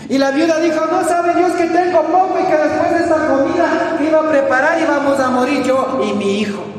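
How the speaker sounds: high, loud preacher voicing a protest; forceful preaching. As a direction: act out the plea at sermon pitch. Preacher voices the widow's protest.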